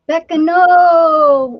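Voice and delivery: long drawn-out call. Real, not acted. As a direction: stretch it out loud.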